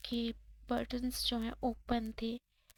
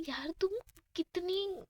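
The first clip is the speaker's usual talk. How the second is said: higher pitched, sputtering frustration; too angry to finish the sentence; exasperated angry cry; frustrated anger; 7 semitones higher, slower than usual. A narrator's reading. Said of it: "ऐसे बोल के प्रीति गुस्सा कर रही होती है".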